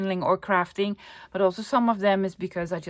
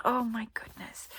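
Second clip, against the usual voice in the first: whisper; 3 semitones higher, 10 dB quieter than usual. Real, not acted. Thrilled gasp over pattern.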